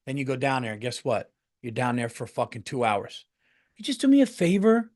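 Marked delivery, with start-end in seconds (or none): 3.8-4.9 higher, sugary favor-asking voice; mock sweet request. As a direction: wheedle the favor out of them. Mimicking friend's request.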